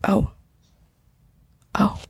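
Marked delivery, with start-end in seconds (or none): 1.7-2.0 slow, hushed whisper. Real, not acted. Podcaster pausing as a friend's meeting starts, whispering. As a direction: whisper it so the call won't hear.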